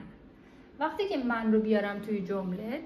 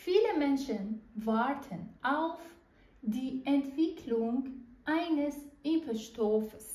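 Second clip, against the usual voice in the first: slow dictation; 3 semitones higher, much slower than usual. Shown as a demonstration. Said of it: "بریم سراغ بایشپیل دوم".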